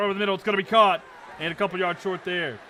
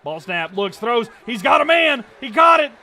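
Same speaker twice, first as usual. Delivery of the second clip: much higher and louder, shouting the big play; excited play-by-play; 8 semitones higher, 11 dB louder than usual. Real, not acted. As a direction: yell the call as the receiver breaks free. Excited live play-by-play call.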